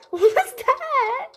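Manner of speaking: high, slow, shaken question; frightened. Real, not acted. Streamer shaken after a scare, high frightened voice. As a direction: still reeling from the fright.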